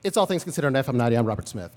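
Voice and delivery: fast radio host read, all in one breath; imitating the news director's one-breath style, then a drop for the name; news director impression. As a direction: rattle it off on one breath like the boss. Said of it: "so it was like this".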